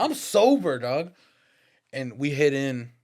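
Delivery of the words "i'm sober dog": mock eager. Eager reply to store plan.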